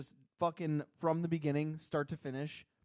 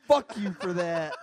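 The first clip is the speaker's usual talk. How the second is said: laughing; 8 dB louder, slower than usual. Real, not acted. Laughing curse at friend.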